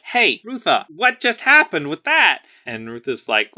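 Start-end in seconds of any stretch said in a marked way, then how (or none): 0.0-2.4 higher, lightly voiced perky teacher; mock-bright check-in, pitched up; curious Nasari. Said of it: "who is like".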